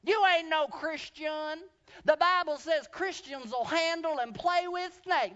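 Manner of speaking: high, loud, slow accusing shout; imitating an old woman yelling in scorn; hammed-up old hag shouting at a man; mock angry shout. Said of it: "And she began to shout".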